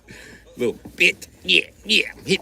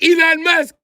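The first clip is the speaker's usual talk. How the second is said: much higher, whiny plea to a rescuer; high pleading complaint; 10 semitones higher than usual. Retold or imitated. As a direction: beg for help up high, wounded. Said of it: "They was like".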